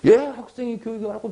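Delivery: 높은 목소리로 반박하는 학생; another student. Retold or imitated. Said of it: "딴 놈이". (반박하는 학생 흉내)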